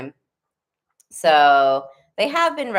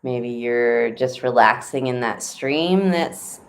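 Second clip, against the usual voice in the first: guided meditation; 2 semitones lower than usual. Acted. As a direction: ease them gently into calm.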